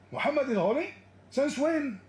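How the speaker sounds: high, mocking disbelief; sarcastic imitation of a baffled caller; mock incredulous quote. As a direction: mimic his shocked question. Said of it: "He said".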